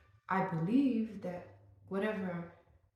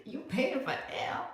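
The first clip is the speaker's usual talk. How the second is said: higher, slow, stunned disbelief; incredulous; 7 semitones higher than usual. Retold or imitated. Vlogger retelling reaction to fiance, slow stunned question. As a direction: drag it out, stunned. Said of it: "I'm like".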